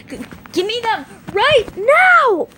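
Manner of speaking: loud high angry command; angry demand. Acted. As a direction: demand the bones back, now.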